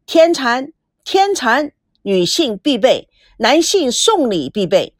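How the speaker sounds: ordinary delivery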